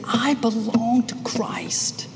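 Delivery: slow hushed whisper, barely voiced; whispered affirmation. Preacher voicing a believer's quiet confession, whispered. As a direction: breathe it out like a private confession.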